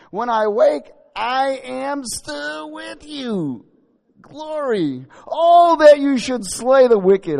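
high, loud impassioned reading; fervent preaching. Acted. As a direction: lift the verse up passionately.

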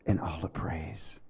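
breathy whispered end of prayer. Acted. Pastor closing the prayer to God, whispered. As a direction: let the last phrase fall to a whisper.

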